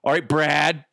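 ordinary talk

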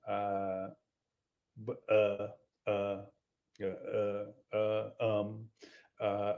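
low, hesitant mumble full of uhs; Zach Johnson. Retold or imitated. Captain telling a player he wasn't picked, awkward stalling. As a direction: stall endlessly before delivering the bad news. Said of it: "He probably says".

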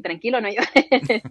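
calm him down tenderly; soothing reassurance. Retold or imitated. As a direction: reporter gently soothing the player, slow and soft. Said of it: "I'm like".

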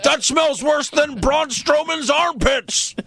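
high, slow put-on voice; imitating Ronaldo. Retelling a friend's roast of the smell, slow high mock voice. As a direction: drag it out in a silly high voice. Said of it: "And then Ronaldo's like".

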